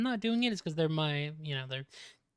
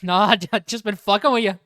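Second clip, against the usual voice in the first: put-on Scottish accent; 3 semitones higher, 9 dB louder than usual. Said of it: "he drops the accent".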